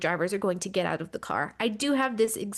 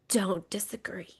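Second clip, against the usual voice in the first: whispered guiltily, unvoiced; 5 dB quieter than usual. Real, not acted. Host whispers agreement with Brad Keselowski's jab, whispering. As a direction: breathe the words like a confession.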